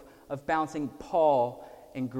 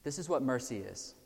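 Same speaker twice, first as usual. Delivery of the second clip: usual speaking voice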